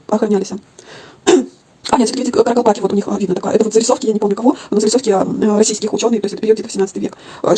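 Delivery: ordinary talk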